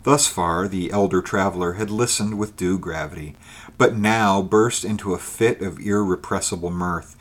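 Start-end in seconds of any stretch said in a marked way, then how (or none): none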